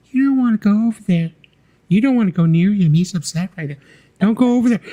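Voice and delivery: very high falsetto impersonation. Retold or imitated. Star warning a guest off the chimp's cage, high falsetto impression. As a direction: warn someone off in a high, put-on voice. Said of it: "he does an impersonation of [name]".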